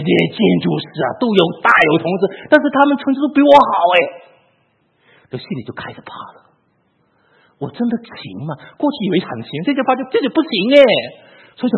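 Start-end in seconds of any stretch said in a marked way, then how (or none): 5.3-6.5 whispered, much softer, as if scared to admit it; a hushed, frightened confession; fearful whisper. Retold or imitated. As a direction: let the fear drop to a breath. Admitting fear at school.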